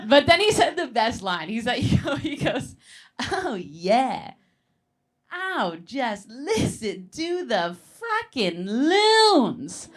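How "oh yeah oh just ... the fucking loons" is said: slow, dreamy, drawn-out stoner voice; stoned blissed-out dad. Retold or imitated. Stoned dad relaxing by the lake, slow blissed-out drawl.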